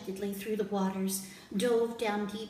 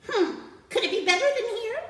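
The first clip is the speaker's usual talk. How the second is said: monkey voice; 12 semitones higher, 7 dB louder than usual. Acted. Monkey doubts.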